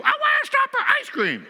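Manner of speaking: very high, loud mock shriek; over-the-top imitation of an upset wife screaming; wailing wife. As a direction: wail it at full pitch like a hammed-up tantrum. Wife's outburst in retold quarrel.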